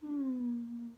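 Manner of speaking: ordinary delivery, nothing marked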